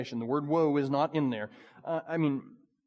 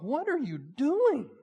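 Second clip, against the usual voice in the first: grieving plea; 10 semitones higher, slower than usual. Acted. Jesus grieving over Pharisees.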